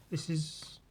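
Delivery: ordinary talk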